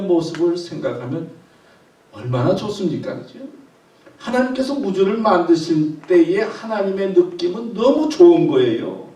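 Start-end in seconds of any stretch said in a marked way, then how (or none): none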